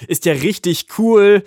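put-on a boy delighted by his first flight; excited call. A narrator's reading. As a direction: shout out the fun of it. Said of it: "ruft er".

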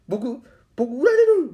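very high, loud and slow whimper; sulking bike voice. Retold or imitated. The bike fears being sold, whimpering very high and loud. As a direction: plead in a tiny wounded voice. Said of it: "拗ねたんや".

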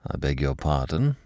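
stiffly polite, pulling back; offended caller turning formal and cool. Acted. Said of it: "he said formally".

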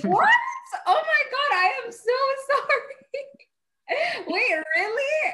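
high, stretched gasping apology with laughter; real shock, laughing and near tears; shocked and apologetic. Real, not acted. Learns friend was hurt.

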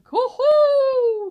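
high, ringing trumpeting call; loud swan cry. A narrator's reading. Cob shows his son how to call, loud high cry. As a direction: let it blare out and hold the last note. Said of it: "its full height and cried".

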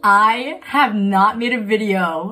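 breathless giggly restart after a laughing fit; after laughing hard. Real, not acted. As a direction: still catching breath from laughing. Let me collect myself.

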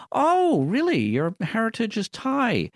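high, drawn-out fake surprise; mock amazement. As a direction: put on an over-keen stranger's squeal of interest. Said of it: "the same as saying".

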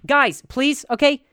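high, loud plea to the row; calling out to talkers. Shown as a demonstration. Comic demonstrating hushing loud moviegoers, loud and high. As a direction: shout it over the theater noise. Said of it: "Go like this".

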